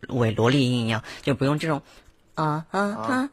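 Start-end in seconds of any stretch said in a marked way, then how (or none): 2.3-3.3 squeaky falsetto loli voice; too-high loli voice. Shown as a demonstration. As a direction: overdo the cute girl voice. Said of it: "不用抬到像那种男生围，围萝莉音一样".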